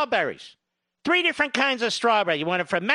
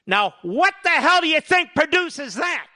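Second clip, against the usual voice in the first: louder, higher, fired-up question; indignant challenge; 4 semitones higher, 6 dB louder than usual. Real, not acted. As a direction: demand an answer from the crowd. Ranting challenge to the audience.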